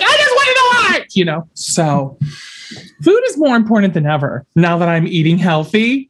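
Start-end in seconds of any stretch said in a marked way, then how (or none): 0.0-1.1 much higher, a whiny wail; high whiny plea. Retold or imitated. Telling the drive-through worker off, high whiny mock plea. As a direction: plead like a thwarted child. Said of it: "And I was like".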